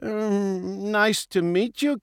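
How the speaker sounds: meek little hesitant voice; nervous politeness. A narrator's reading. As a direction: greet him timidly. Nervous polite greeting to villain.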